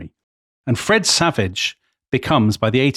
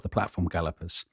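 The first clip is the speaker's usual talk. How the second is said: much softer, lower repeat; quiet aside; 5 semitones lower, 12 dB quieter than usual. Real, not acted. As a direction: drop it under your breath. Quiet repeat of ride name.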